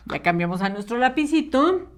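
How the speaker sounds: ordinary talk, nothing marked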